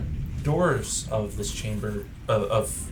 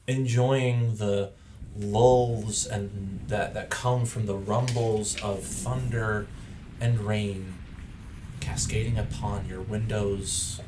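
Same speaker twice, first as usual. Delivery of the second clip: story narration; 4 dB quieter than usual. A narrator's reading. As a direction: set the scene softly over music. GM narrates the moody scene.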